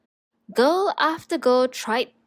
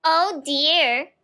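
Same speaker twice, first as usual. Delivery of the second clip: mock giggle; 5 semitones higher than usual. A narrator's reading. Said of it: "giggled the stepsisters".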